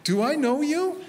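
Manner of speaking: high mock-puzzled question. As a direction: act surprised to be recognized. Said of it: "I go".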